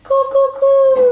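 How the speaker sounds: high loud coo coo like a pigeon; mock pigeon coo; bird cooing. Parent voicing bird in picture book.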